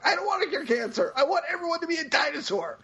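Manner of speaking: high-pitched retort at normal volume; mock yell. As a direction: imitate a villain yelling back. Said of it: "And he yells back".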